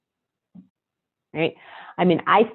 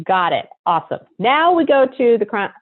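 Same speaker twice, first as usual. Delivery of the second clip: usual speaking voice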